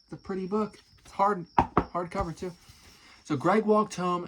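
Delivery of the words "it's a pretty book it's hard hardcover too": plain reading voice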